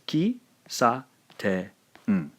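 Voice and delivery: "ki, sa, te, n" one syllable at a time; separate syllables. Shown as a demonstration. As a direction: slowly space out "ki, sa, te, n" with a gap after each. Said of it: "Pronounce this as".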